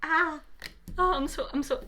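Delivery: normal talking voice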